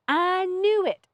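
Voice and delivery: high, drawn-out disgust; disgusted I-told-you-so; muttered disgust. A narrator's reading. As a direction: stretch it out with heavy distaste. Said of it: "disgust heavy in her voice".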